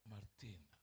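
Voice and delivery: low, soft, tender name; gentle calling. Acted. Jesus calling a woman by her name, gently. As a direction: call the name softly and low. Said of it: "dengan sebutan yang lembut".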